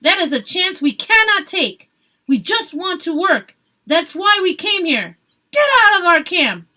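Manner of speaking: loud, high, ordering them out; angry shout. A narrator's reading. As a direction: drive them off with force. Worker ordering protesters out.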